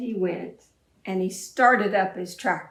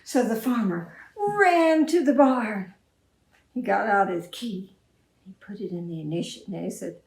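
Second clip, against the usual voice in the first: ordinary delivery, nothing marked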